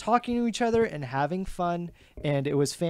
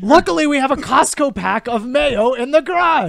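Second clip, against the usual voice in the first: high, loud perky mock housewife; snooty suburban voice; 9 semitones higher, 7 dB louder than usual. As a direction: play a chirpy rich spouse.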